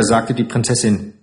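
usual speaking voice

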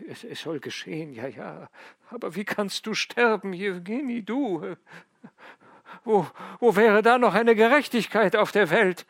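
put-on an old man's despairing cry at injustice; anguished father. A narrator's reading. Old father crying out that his son cannot die, anguished.

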